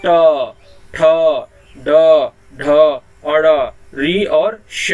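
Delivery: ट, ठ, ड, ढ spoken loud and slow, each set apart; retroflex consonants spelt out. Shown as a demonstration. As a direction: say each retroflex letter with a pause after it. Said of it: "देखिए ना". (मूर्धा से ट, ठ, ड, ढ ज़ोर से और धीरे)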